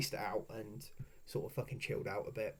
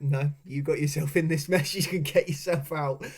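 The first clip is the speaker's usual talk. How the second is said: loud, laughing refusal to help; teasing refusal; 3 semitones higher, 8 dB louder than usual. Retold or imitated. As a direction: throw it back at him with a laugh.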